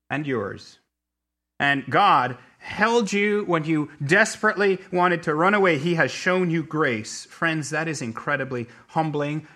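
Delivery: ordinary talk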